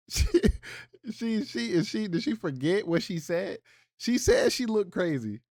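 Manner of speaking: can't believe what she just heard; incredulous. Real, not acted.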